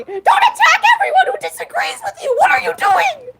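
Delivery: high-pitched, squealing protest; playful frantic scolding. Real, not acted. Streamer stopping Thunder threatening Red, frantic playful scolding.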